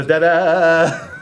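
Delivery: a playful ta-da dissolving into laughter; laughing fanfare. Real, not acted. Quiz host celebrating a correct answer, laughing fanfare. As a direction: toot a mock fanfare, then laugh.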